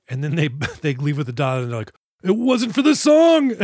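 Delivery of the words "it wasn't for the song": mock villager voice. Villagers voiced mocking the British.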